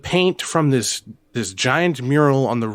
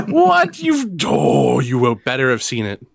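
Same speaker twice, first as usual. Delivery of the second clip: mock outrage; 3 semitones higher, 5 dB louder, slower than usual. Real, not acted. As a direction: shout in exaggerated disbelief.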